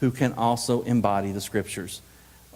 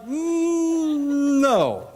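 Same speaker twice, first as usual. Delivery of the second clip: long high hum, then no; reluctant refusal; 13 semitones higher than usual. Acted. Team leader answering an outlandish idea, high strained reluctant refusal. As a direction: stall on a high drawn-out hum before refusing.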